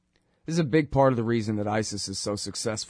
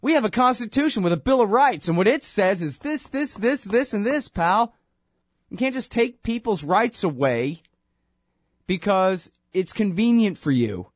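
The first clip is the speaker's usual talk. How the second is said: high-pitched, louder mock judge lecturing; imitating the judge; 9 semitones higher, 4 dB louder than usual. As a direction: lecture him like a cocky judge, much higher. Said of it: "And Napolitano's like".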